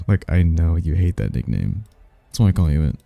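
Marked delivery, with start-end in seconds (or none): none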